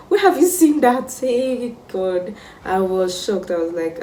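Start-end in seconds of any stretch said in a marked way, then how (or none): 0.1-2.3 high, loud incredulous outburst; outraged disbelief. Retold or imitated. Student accused of pursuing dogs, outraged and incredulous. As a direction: exclaim at an absurd accusation.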